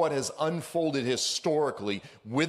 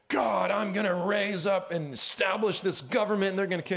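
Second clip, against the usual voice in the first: higher, put-on devilish taunting voice; mock Satan voice; 10 semitones higher than usual. Preacher voicing Satan taunting God, higher mocking put-on voice. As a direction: play Satan boasting like an online troll. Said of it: "Satan's like".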